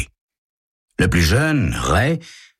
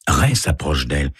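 plain reading voice

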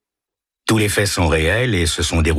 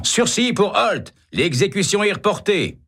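shouted announcement. A narrator's reading. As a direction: announce the reprieve at the top of the voice. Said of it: "en criant".

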